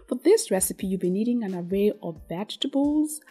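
ordinary talk, nothing marked

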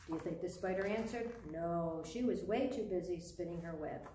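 ordinary delivery, nothing marked

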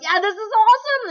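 hammed-up thrill; mock excited prospect. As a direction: squeal it like a fan. Broke prospect loves the pitch.

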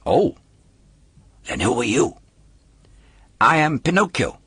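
a hint of an indignant wooden boy; puppet voice. A narrator's reading. Puppet corrects the polecat, a touch indignant.

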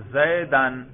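زیدن said as "Zaydan", ending in "-an"; demonstrated case ending. Shown as a demonstration. Teacher quizzing students on case by sound, demonstrated ending. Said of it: "میں صرف تلفظ کر رہا ہوں زبان سے".

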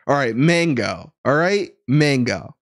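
"mango" as "MAN-go" with a flat a; "mango" with a drawn flat first vowel; American pronunciation. Pretend. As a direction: pointedly repeat "mango" the American way. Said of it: "Now he's saying mango".

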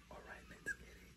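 hushed whisper so she stays asleep. Real, not acted. Boyfriend whispering beside his sleeping girlfriend, hushed whisper. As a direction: breathe it out under your breath.

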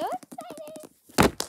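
slow, sing-song high squeal; so excited. Real, not acted. Vlogger gushing over her book, slow high sing-song excitement. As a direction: draw it out high like a happy little song.

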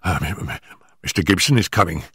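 high, hurried, stammering nervous man; gasping, out of breath. Acted. Nervous manager warning Holmes before his employer arrives, gasping and hurried. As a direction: blurt it out in short frightened bursts. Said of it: "our visitor gasped, shooting out short sentences like a man who was out of breath".

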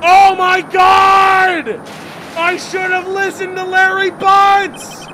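high, loud panicked cry, slow; falling in terror; terrified shout. Acted. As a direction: cry out as the bridge gives way. Bridge plank cracks beneath.

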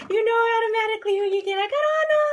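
high, loud, fast squeal of delight; excited squeal. Real, not acted. Unboxing fan squealing at finding Anna, high and loud. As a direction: squeal it out, thrilled.

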